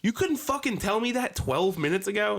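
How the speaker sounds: high, loud mock shouting; retold yell. Yelling out the window at the worker, mock furious. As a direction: blast the complaint up high. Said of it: "I'm like".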